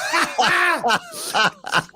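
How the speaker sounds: high-pitched burst of laughter; big high laugh. Real, not acted. Laughing at accent remark.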